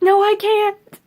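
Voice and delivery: high, loud panicked cry of "No, I can't"; reliving a panic attack at work, loud and high; panicked protest. Retold or imitated. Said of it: "And I'm like".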